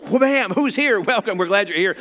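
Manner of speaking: startled greeting. Acted. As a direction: jump as the door swings open, then greet. Startled crowd at the door.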